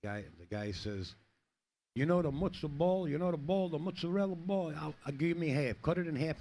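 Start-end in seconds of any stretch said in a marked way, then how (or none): 2.0-6.4 put-on loud, higher, swaggering Italian-American Guido accent; brash New York Italian accent, cranked up high and loud; mock Italian Guido. Polish guy ordering at the counter in a loud mock Italian Guido accent. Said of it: "Learn how to be a Guido".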